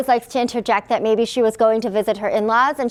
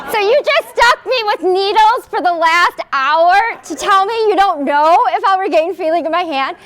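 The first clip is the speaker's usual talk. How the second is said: higher and louder, outraged at the doctor; indignant disbelief; 8 semitones higher, 4 dB louder than usual. Retold or imitated. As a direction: demand it, incredulous.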